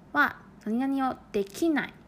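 usual speaking voice

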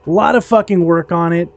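ordinary talk

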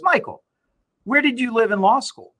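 imitating a smug lawyer; pointed retort. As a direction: toss the question back, sharply.

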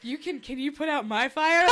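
giggling, teasing come-on; laughing while pretending to swoon over the firefighter; laughing flirty plea. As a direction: laugh through a mock swoon. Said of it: "I was like".

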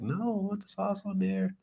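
high mock wife voice; wife's voice. Wife's reply mimicked in story.

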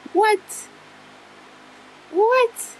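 high pitched shocked squeak, twice; stunned what. Retold or imitated. Daughter hearing mom knows she's pregnant, shocked high squeal. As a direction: leap way up in disbelief.

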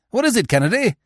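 a quick worried question over the engine; lightly voiced concern; urgent question. A narrator's reading. Asking the man behind.

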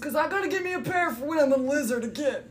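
high, excited NPC voice; bouncy high-pitched sidekick; high character voice. Acted. NPC talks about Yeezys.

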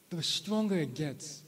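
ordinary talk

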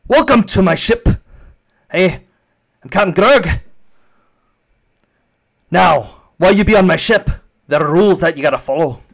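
loud, high, gruff captain barking rules; grumpy space dwarf captain. Acted. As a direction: lay down the law to new passengers. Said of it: "he looks down towards you and goes".

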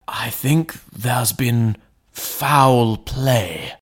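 slow, breathless whispered dread; hoarse whisper. A narrator's reading. Butler confiding his fear to the lawyer, slow hoarse whisper. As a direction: whisper it slowly, as if afraid to be overheard. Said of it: "said Poole hoarsely".

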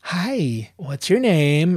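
singsong receptionist hello; greeter at the door. Retold or imitated. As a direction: imitate a perky door girl. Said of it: "the girl's like".

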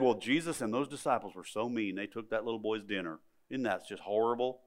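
low, soft mock-shocked complaint; hushed mock disapproval. Critics of Jesus taking a boy's dinner, hushed mock disapproval. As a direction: drop low and quiet, imitating scandalised critics.

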